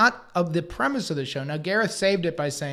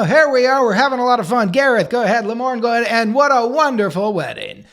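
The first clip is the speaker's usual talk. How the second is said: loud, high, bubbly host voice; host mode; 6 semitones higher, 8 dB louder than usual. Retold or imitated. Himself hyping the show as host, loud and high. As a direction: pump it up like a TV host. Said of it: "I was gonna be in host mode".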